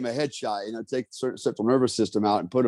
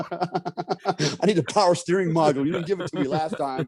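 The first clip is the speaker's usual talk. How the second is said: higher, joking demand for the power steering module; teasing put-on voice, higher; mock demand; 6 semitones higher than usual. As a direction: hand over that stick, tongue in cheek. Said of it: "I said".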